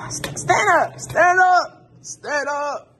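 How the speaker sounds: loud, high, exasperated yell; frustrated shout. Real, not acted. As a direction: yell at the stubborn tripod. Yelling at the falling tripod.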